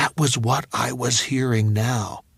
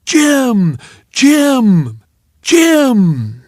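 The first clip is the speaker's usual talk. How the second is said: loud, high chanted name; crowd bellow; 10 semitones higher, 8 dB louder, much slower than usual. Retold or imitated. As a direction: bellow it from the bleachers. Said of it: "the hometown crowd would bellow".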